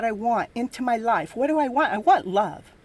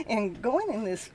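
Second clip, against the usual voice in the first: usual speaking voice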